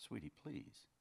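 soft, hushed plea; pleading. Acted. Man begging his silent lover, softly pleading. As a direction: beg quietly for an answer.

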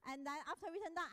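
normal talking voice

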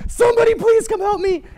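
high, loud mock panic; desperate plea. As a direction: scream for help through a door. Roommate banging on door for help.

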